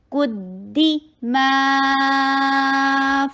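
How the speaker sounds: ما of قدی ما held four counts; very slow recited قدی ما; long madd demo. Shown as a demonstration. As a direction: draw ما out long. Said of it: "four حرکہ long".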